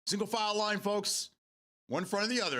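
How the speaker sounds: high, projected crowd-marshal call; mock announcement, pitched high and carrying; calling out orders. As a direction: pretend to herd a line of people.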